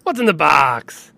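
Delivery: loud, drawn-out movie-quote cry; Brad Pitt. Acted. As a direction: wail it slowly like a hammed-up film climax. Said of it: "when I think of Brad Pitt".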